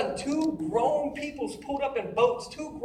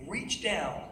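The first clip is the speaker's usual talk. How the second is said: soft, low dramatic narration; hushed storytelling; 4 semitones lower, 11 dB quieter, slower than usual. A narrator's reading. Storyteller recalls sinking and being rescued, soft hushed narration. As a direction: bring it down to a solemn hush.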